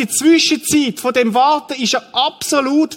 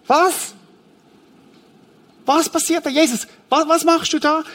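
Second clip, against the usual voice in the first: higher-pitched, incredulous questioning; astonished bystander; 7 semitones higher than usual. Acted. Onlookers questioning Jesus at the grave, astonished. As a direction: act a bewildered onlooker challenging what is going on.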